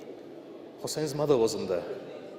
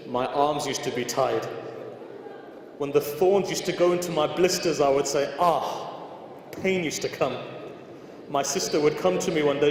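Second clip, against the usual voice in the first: raised, pleading sermon voice; impassioned preaching lament; 3 semitones higher, 3 dB louder than usual. Acted. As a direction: preach the grief out to the hall. Preaching the lament at full force.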